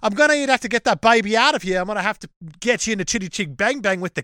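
put-on British chimney-sweep voice; mock Cockney. Podcaster as a sweep threatening an unborn baby, hammed-up Cockney. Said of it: "You're not Cockney".

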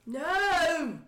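put-on high, drawn-out drunken protest; drunk Praline. Drunk woman denies mocking her listener, high wailed protest. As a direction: swoop up high in tipsy denial. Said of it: "drunk Praline explaining yoga".

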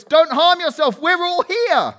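high, loud call across the prison; loud cry. A narrator's reading. Paul calling out loud to the jailer, a high loud cry. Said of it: "cried with a loud voice".

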